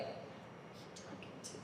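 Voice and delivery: mock nosy whisper, barely audible; imitated whisper. She stops by the desk at night, imitated whisper. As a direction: whisper the question, imitating her.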